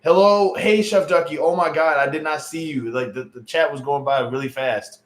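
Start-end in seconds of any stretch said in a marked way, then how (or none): none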